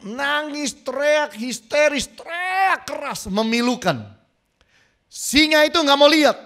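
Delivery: high, loud, anguished sermon peak; preacher shouting a mother's despair; preaching at full force. Acted. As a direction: cry it out to move the crowd. Preacher voicing mother's screams.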